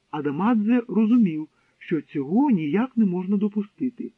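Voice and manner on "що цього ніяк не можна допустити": usual speaking voice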